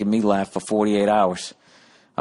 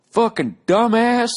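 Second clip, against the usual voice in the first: put-on high, loud, slow drunk crowd heckle; yelling heckler; 5 dB louder, slower than usual. Heckler in Mobile yelling at the comic, high and loud. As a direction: holler it from the back row. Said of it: "hear this guy go".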